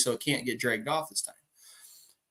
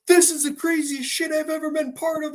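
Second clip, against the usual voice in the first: much higher, frantic imitation; worked-up; 12 semitones higher than usual. Shaken buddy after the bear chase, worked up. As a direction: imitate someone all hyped up and shaking. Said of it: "He's so worked up".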